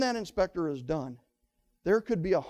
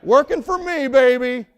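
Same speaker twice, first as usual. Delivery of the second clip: delighted buyer; 3 semitones higher, 6 dB louder than usual. Retold or imitated. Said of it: "They're like".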